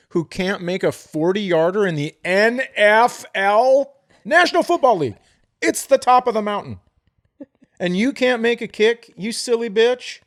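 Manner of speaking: loud, high-pitched outraged rant; fired-up yelling. Real, not acted. Host ranting at kickers who miss, fired-up yelling. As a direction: yell it, indignant and incredulous. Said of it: "Sorry, I'm yelling".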